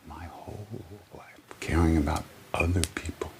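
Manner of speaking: whispered repeat; soft, whispered emphasis. Shown as a demonstration. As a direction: drop to a hush to make it land. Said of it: "Let me say it again".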